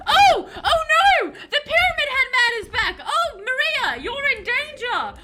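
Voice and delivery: high, loud hammed-up alarm; mock panic. Player warning Maria about Pyramid Head, loud mock panic. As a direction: shout a mock warning at full pitch.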